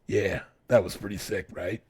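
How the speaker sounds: low, soft dopey bro voice; mocking bro voice. Friend mocks reckless riders in a low dopey voice. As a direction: drop low and play the careless rider. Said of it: "being like".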